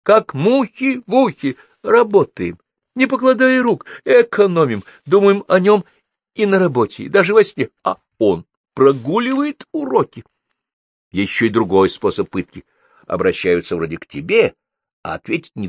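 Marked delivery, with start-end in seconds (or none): 0.0-10.2 high-pitched, emphatic tirade; worked-up mother complaining to granny; indignant crying out. A narrator's reading. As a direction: hammer out the grievance in a raised voice. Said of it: "крикнула она бабушке".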